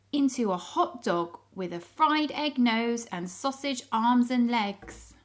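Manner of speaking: plain reading voice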